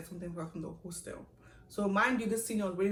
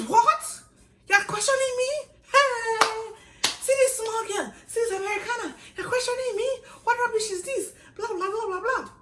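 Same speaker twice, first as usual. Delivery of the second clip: much higher, loud outraged tirade; ranting senior; 8 dB louder than usual. Retold or imitated. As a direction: rant at the small girl, scandalised. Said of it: "she's just there parabolating".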